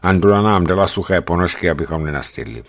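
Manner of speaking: ordinary delivery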